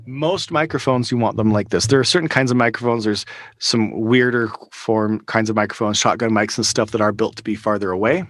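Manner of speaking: ordinary talk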